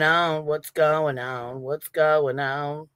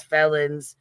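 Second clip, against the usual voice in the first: usual speaking voice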